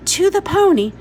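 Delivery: hope it's the pony; eager boy. A narrator's reading. Jamie hoping to go to the pony, high and eager.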